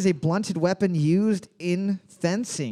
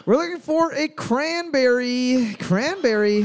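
announcer reveal; 6 semitones higher, slower than usual. Acted. Host reveals answer.

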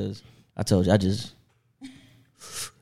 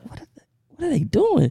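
confused; 14 semitones higher than usual. Retold or imitated. Retold confused reaction.

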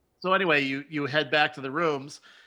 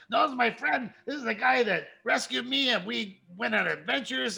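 wolf talking eagerly; 6 semitones higher than usual. Acted. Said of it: "you hear him talking".

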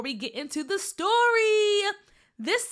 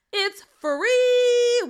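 excited; 11 semitones higher, 10 dB louder than usual. Real, not acted. Excited host announcing free event.

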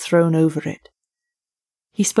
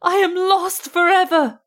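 much higher wail of despair; despairing cry; 11 semitones higher than usual. Acted. Student crying that he is doomed, high wail. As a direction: howl it as a doomed man.

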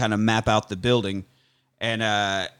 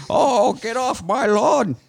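put-on high, slow, cranky old geezer; grumpy old man; 10 semitones higher, much slower than usual. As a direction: shake your fist at the kids, slowly. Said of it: "Old Man".

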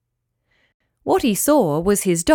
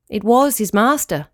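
ordinary delivery, nothing marked

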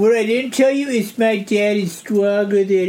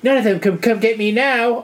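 usual speaking voice

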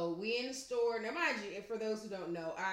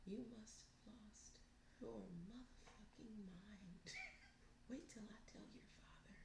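leaned-in threat; 21 dB quieter than usual. Acted. Stepmother leaning in to the misbehaving child, whispered threat. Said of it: "I leaned in and I said".

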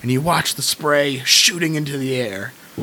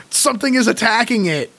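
ordinary talk

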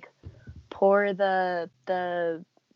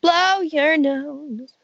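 slow, louder teasing order; slow mock command; 6 semitones higher, 6 dB louder, much slower than usual. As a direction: drawl it out teasingly.

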